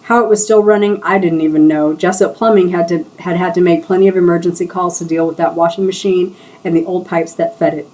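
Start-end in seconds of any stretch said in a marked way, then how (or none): none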